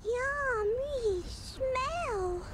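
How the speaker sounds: very slow, high and soft creature croon; hungry creature savouring a smell. Acted. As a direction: purr it like a hungry little beast. Creature sniffing out food.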